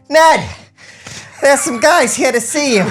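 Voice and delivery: camp it up high as Nancy; Nancy impression. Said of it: "Nancy would have been like".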